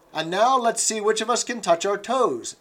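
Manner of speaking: rally the class like a keen fitness instructress; enthusiastic. Acted. Instructress rallying her exercise class, enthusiastic and loud. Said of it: "she said enthusiastically".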